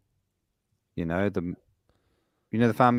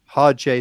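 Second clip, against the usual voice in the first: "Hard J" punched louder; emphatic contrast; 4 semitones higher, 8 dB louder than usual. Shown as a demonstration. Insisting on his pronunciation to friends, "Hard J" loud and emphatic. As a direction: hit "Hard J" hard.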